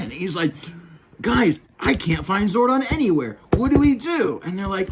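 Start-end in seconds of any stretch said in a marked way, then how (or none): 1.2-4.4 higher, louder worried appeal; hammed-up cartoon panic; mock alarm. Ranger telling the team Zordon is missing, mock alarm.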